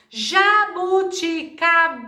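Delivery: JABUTICABA slow and high, in five separate beats; syllable by syllable. Shown as a demonstration. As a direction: count out JABUTICABA one syllable at a time. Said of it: "tem cinco sílabas".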